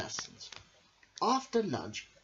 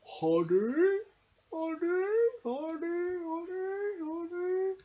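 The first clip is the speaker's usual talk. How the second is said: greedy bear; slower than usual. Acted. Norman the bear sees the honey store and can't help himself. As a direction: moan for honey, unable to resist. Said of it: "couldn't help himself".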